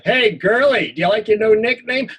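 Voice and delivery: high, loud mocking taunt; mocking bully. Bully mocks the boy about his new nickname, loud. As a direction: jeer at him like a schoolyard bully.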